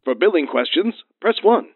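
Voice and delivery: polished recorded hotline voice; phone menu voice. Acted. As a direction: read it like an automated menu.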